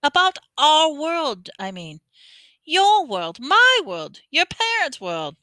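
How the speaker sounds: lightly voiced giant; Hagrid voice. A narrator's reading. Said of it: "Hagrid simply waved his wand and said".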